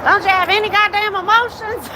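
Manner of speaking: high, loud squeaky mock scold. Scolding a stoic viewer who won't cry, shrill mock outrage.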